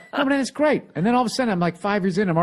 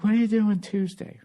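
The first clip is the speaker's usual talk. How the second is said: higher-pitched mock girlfriend voice; girlfriend imitation; 5 semitones higher than usual. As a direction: put on her wheedling voice as you hear it. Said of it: "She doesn't talk like that. That's just how it sounds in my head".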